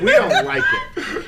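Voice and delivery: very high, loud squeal of we don't like it; laughing mock whine. Mocking, laughing.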